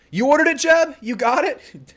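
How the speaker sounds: high-pitched, thrilled questions; excited surprise. Real, not acted. Reacting to viewer's jersey purchase.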